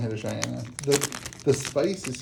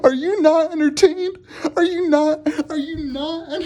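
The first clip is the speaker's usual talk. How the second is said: much higher and louder, breaking into laughter; triumphant shout; 7 dB louder than usual. Real, not acted. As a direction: yell it like a gladiator to the crowd.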